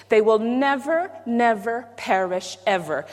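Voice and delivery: slow, stretched "never, never perish ever"; emphatic "never", repeated slowly, through to "ever"; big emphasis. Shown as a demonstration. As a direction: lean long and hard on each "never". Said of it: "That's big emphasis".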